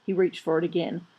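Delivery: usual speaking voice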